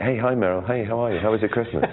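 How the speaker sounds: put-on bright, casual hello to an old friend; chirpy and offhand, like a neighbour; breezy chummy greeting. Mock casual greeting to Meryl.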